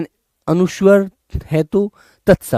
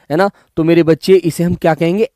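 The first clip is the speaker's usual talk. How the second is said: usual speaking voice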